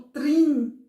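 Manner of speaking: a firm, emphatic "trim"; isolated syllable. Shown as a demonstration. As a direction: punch out "trim" as a single syllable. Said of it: "essa sílaba aqui".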